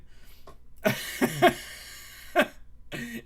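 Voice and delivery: long high wheezing laugh; helpless laughing fit. Real, not acted. Streamer after naming the friend Adrian, helpless laughter. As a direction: laugh till the breath runs out.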